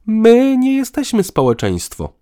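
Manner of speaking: high, loud indignant retort; irritated huff. A narrator's reading. Woman rejects partner's talk of society, irritated. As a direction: huff back with rising irritation. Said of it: "fuknęła coraz bardziej poirytowana".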